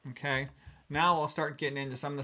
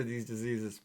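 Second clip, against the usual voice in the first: ordinary delivery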